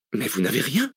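surprised exclamation with swooping pitch; exclaiming. A narrator's reading. Cécile inspecting the professor's wound, exclaiming. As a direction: exclaim in disbelief. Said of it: "s'exclama-t-elle".

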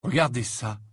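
plain reading voice

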